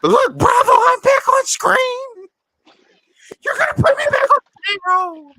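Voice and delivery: high-pitched, loud mock Vicky shrieking; hammed-up, high shouted demand; Vicky impression, yelling. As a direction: yell it like an over-the-top reality star demanding her spot. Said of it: "and then she gets on".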